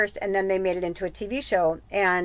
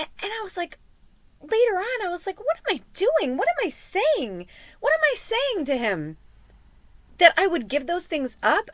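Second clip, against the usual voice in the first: distraught self-quote; 10 semitones higher than usual. Retold or imitated. Retelling her own panicked thoughts.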